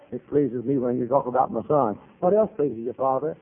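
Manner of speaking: ordinary talk, nothing marked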